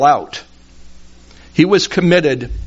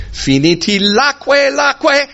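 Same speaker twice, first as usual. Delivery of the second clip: "laque laque" held way up high; high final tone; 11 semitones higher than usual. Shown as a demonstration. Preacher shows John three sixteen's Sango ending, "laque" high. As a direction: pitch "laque laque" high and stop there. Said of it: "end it on the high tone".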